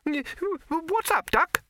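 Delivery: high, squeaky little rabbit voice; a high, nervous talking rabbit. Acted. Rabbit character voice.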